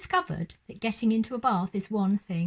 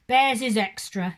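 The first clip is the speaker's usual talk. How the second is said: gruff cabbie growl, a bit louder; 6 dB louder than usual. A narrator's reading. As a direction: grumble it like a surly taxi driver. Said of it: "he growled".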